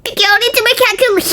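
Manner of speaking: put-on high, nerdy voice of a smart kid; know-it-all kid. Smart kid predicts the storm in a high nerdy voice. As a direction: announce it like a little genius.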